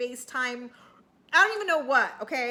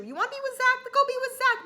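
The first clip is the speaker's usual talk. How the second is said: high, louder mock confrontation; emphatic challenge; 12 semitones higher, 5 dB louder, faster than usual. [name] challenging Nicole to pick Zach, high and forceful. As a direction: push her to choose, pitched way up.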